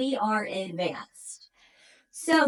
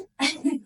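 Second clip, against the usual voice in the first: breathless laugh; 4 semitones higher than usual. Real, not acted.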